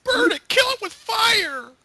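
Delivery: very high, loud yell; mock battle cry. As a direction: scream it like a crazed mob. Joining the burn chant.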